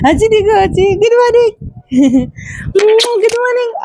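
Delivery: high playful coaxing. Real, not acted. Coaxing baby again.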